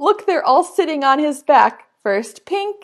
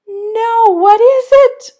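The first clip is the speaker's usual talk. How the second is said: high, loud amazed question; surprised; 7 semitones higher, 7 dB louder, slower than usual. Acted. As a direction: gasp at the surprise. Surprised at alligator.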